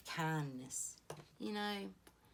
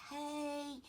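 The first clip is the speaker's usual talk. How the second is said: put-on a bright high little-kid "hey", much higher; child's greeting; 10 semitones higher than usual. Grandson in back seat greets the drive-thru lady, high childlike. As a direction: pitch way up like a small boy calling out.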